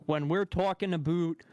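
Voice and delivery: "a boot" with exaggerated long oo for about; YouTuber's "a boot". Mimicking a YouTuber, "a boot" with long oo. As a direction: imitate him saying "a boot", rhyming with suit. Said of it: "But he says".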